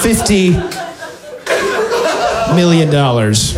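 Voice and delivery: a hint of a proud clueless player; clueless Scrabble player. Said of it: "person who doesn't understand the rules of Scrabble".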